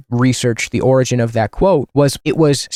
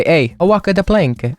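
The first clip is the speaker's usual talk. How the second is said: higher-pitched cartoonish Italian accent; 6 semitones higher than usual. Villain ordering captives off a pirate ship, Italian accent. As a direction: put on a mock Italian accent. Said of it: "I give him an Italian accent".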